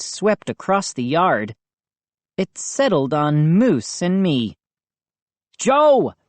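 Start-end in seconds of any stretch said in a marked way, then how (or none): 5.6-6.1 high, louder mock scream. A narrator's reading. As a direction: yell the son's name. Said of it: "Dad screamed".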